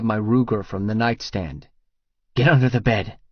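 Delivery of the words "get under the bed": usual speaking voice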